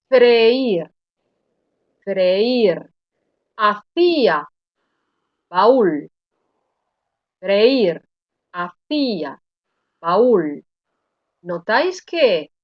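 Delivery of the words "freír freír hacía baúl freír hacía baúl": freír, hacía, baúl with the weak í and ú stressed and split off; slow teacher's split: fre-ÍR, ha-CÍ-a, ba-ÚL; pronunciación didáctica del hiato; clear hiatus demo. Shown as a demonstration. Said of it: "Voy a pronunciaros estas palabras".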